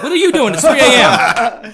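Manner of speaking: high, loud indignant retort; startled shouting back. Retold or imitated. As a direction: yell it back at a stranger in the dark. Retold yelling back at hiker.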